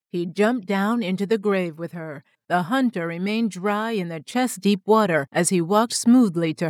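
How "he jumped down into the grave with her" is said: usual speaking voice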